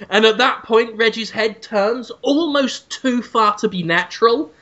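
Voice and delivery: normal talking voice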